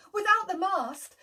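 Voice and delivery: plain reading voice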